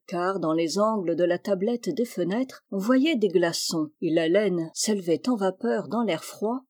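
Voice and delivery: ordinary delivery, nothing marked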